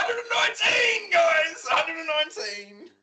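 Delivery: shouting it high and triumphant, laughing at the end; gleeful shout. Real, not acted. Teasing his co-host by shouting the number. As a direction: yell the number out to tease him.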